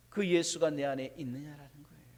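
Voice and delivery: usual speaking voice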